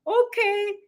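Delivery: high soothed "okay, okay" from a client; imitating clients. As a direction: play the reassured client agreeing. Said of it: "they're like".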